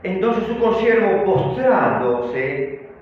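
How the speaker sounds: loud, drawn-out Bible reading; scripture reading. A narrator's reading. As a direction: proclaim the verse with slow, forceful emphasis. Reads the scripture verse aloud.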